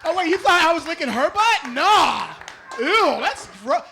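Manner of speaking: loud grossed-out denial; mock disgust. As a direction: act appalled at the very idea.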